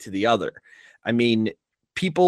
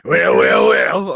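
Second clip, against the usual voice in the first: a botched stab at a British accent that slides into Fat Albert; a clumsy British accent attempt; failed British accent; 5 semitones higher, 6 dB louder, slower than usual. Shown as a demonstration. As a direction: try a posh British "well, well" and miss. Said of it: "Is that Fat Albert?".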